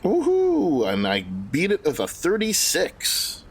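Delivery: ordinary delivery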